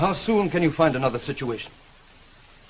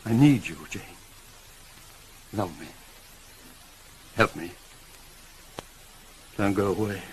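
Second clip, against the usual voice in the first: soft plea; 6 semitones lower, 5 dB quieter than usual. Acted. Rochester pleading with Jane.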